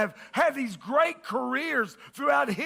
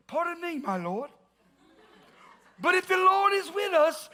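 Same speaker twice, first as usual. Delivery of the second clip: hammed-up British accent, higher on Pardon and the Lord; bad British accent; 6 semitones higher than usual. Pastor voicing Gideon to the angel, bad British accent. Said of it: "hallmark British accent, meaning very bad".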